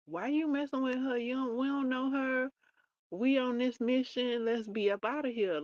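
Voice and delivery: high-pitched, urgent nagging; acted-out impatient complaint; impatient objection. Acts guide objecting to the girl helping.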